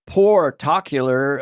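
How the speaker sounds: portocular, a slow mangled "particular"; odd pronunciation. Shown as a demonstration. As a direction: say portocular as "por-TOCK-ular". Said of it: "How do you pronounce it?".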